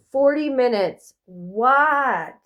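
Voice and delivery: genuinely shocked, blurt it out; surprised. Real, not acted. Reader sees the clock and blurts, shocked.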